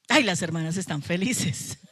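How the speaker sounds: ordinary talk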